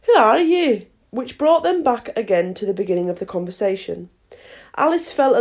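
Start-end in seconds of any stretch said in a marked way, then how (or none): 0.1-0.9 high, louder scornful question; contemptuous caterpillar. A narrator's reading. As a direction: challenge with a touch of contempt. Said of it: "said the caterpillar contemptuously".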